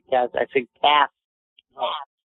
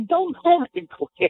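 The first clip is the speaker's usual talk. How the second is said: much higher, drawn-out laughing objection; laughing protest; slower than usual. Real, not acted. Host teased by co-host, laughing protest. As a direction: protest slowly through high giggles.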